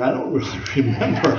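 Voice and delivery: chuckling mid-sentence at his own joke; a laugh breaks into the line; laughing through it. Real, not acted. Preacher joking about forgetting to shut the door, laughing.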